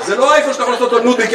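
ordinary talk

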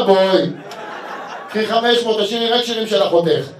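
loud happy deal. Retold or imitated. Comedian shouting his deal to the singer's sister, loud. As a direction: shout the offer like closing a bargain.

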